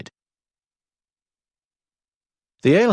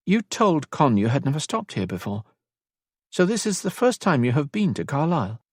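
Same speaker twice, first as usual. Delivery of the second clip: higher-pitched, curious questioning; 7 semitones higher than usual. A narrator's reading. Elisand questions Rory about his first visit, curious. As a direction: ask with curiosity, pitch lifted throughout. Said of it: "Elisand asked with curiosity".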